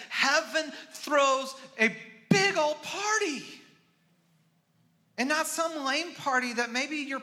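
loud, high, joyful proclamation; excited preaching. Real, not acted. Preacher exulting to the congregation about heaven's party, loud and high. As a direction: shout the good news with joy.

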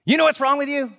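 put-on high, pointed question from an old professor; imitating the old prof's sharp question; mentor's manner. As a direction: challenge the young men like a blunt mentor. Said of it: "that's how he talked".

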